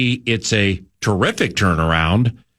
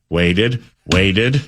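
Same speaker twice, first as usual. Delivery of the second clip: ordinary talk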